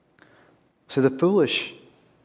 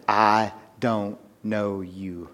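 very slow, softer, drawn out; frightening hushed verdict; 6 semitones lower, 8 dB quieter, much slower than usual. Acted. Preacher repeating the bridegroom's words to the congregation, slow and hushed. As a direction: let the words land with dread. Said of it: "any more frightening words".

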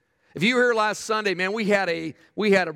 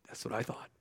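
breathy whispered aside; a teasing whispered aside; 10 semitones lower, 15 dB quieter than usual. Real, not acted. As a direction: drop to a whisper for the joke. Pastor's aside after show of hands.

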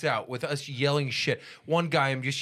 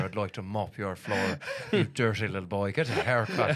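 put-on low, comic scolding in an Irish accent; Irish Missus Doubtfire; 5 semitones lower than usual. Joking with friends as Irish housekeeper, put-on scolding Irish voice. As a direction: play an insulting Irish housekeeper for laughs. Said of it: "That's right, Irish Missus Doubtfire".

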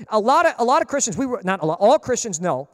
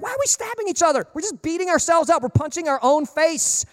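higher-pitched, incredulous pleading; exasperated protest; 6 semitones higher than usual. Retold or imitated. Preacher reacting to both sides hitting back, exasperated. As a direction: plead in disbelief at the infighting.

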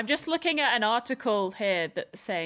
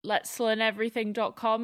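ordinary talk

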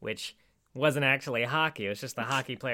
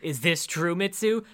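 raised, louder acted questioning; mock confrontation; 5 semitones higher, 5 dB louder than usual. Confronting Mitsu about the scepter, mock-dramatic questioning. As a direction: put the accusation to her, pitched up.

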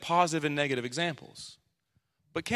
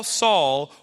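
second Saul dropped back to normal pitch; voice of Jesus calling; 5 dB louder than usual. Retold or imitated. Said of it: "he heard a voice saying to him".

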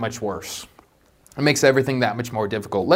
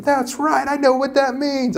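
high, thrilled proud exclaim; excited nerd; 10 semitones higher than usual. Retold or imitated. Pastor recalling a Super Bowl ad, high excited gloat. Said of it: "and I was so excited".

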